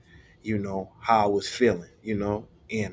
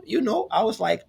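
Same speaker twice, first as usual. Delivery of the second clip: normal talking voice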